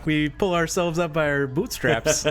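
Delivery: mock Minnesota accent. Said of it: "same idea as they're like".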